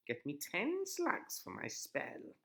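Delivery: high, slow bossy witch. A narrator's reading. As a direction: order it like a witch. Witch voiced.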